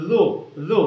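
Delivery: লু said as careful "dhu", tongue at the teeth; careful pronunciation. Shown as a demonstration. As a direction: place the tongue tip precisely for লু. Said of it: "উচ্চারণের সতর্কতা কোথায়".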